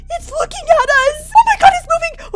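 screaming as the ghost turns toward them; terrified scream. Real, not acted.

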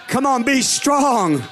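slow, strong declaration; preacher's slow push on strong; forceful. Shown as a demonstration. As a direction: say it like no weak word. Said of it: "That wasn't no weak little word there".

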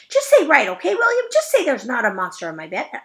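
desperate loud nagging; high, loud demand. Acted. Junie B loudly begs William to say there's no monster, high and loud. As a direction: shout it high, begging.

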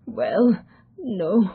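reluctant, hesitant admission; a touch of hesitation on each word. A narrator's reading. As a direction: admit it haltingly. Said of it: "replied the young man hesitantly".

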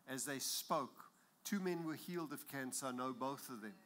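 usual speaking voice

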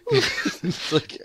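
laughing. Real, not acted. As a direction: laugh through the words. Laughing during a joke.

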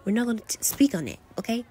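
ordinary delivery

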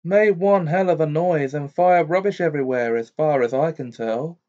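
brisk, pressing news; Amos Diggory. A narrator's reading. Diggory's head speaking.

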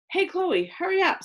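high, pushy call; impatient. A narrator's reading. Max telling Chloe to move, impatient.

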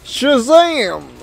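excited. Real, not acted. Playful exclamation in game.

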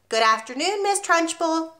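put-on high, sing-song chorus of small children; chirruping children. The class chirrups back at their headmistress, high and sing-song. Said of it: "they chirruped".

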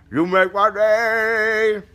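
high, loud yell with stretched words; slow, high-pitched mock holler; hammed-up shout. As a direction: belt out the catchphrase, dragging it out. Hammed-up repeat of requested catchphrase.

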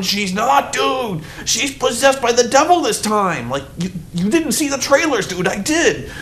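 high, insistent protest at the screen; exasperated audience protest. Retold or imitated. Audience yelling at the preacher, high exasperated protest. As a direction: argue with the preacher from the seats. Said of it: "everyone in the audience is going".

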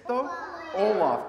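ordinary talk

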